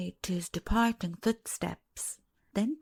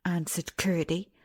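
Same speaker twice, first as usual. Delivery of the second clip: ordinary delivery, nothing marked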